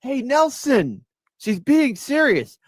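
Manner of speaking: high, slow mocking Nelson voice; lightly voiced cartoon bully; Nelson impression. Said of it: "that does a terrible Nelson".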